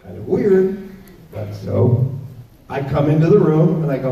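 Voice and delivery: ordinary delivery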